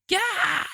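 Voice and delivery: high-pitched awkward yelp; imitated weird scream. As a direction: mimic a weird, startled-sounding scream. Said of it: "this weird and awkward ... scream".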